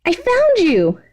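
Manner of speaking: put-on high, giddy girl's squeal; excited high voice for the villain girl; Toga's voice. Toga bursts in, found him.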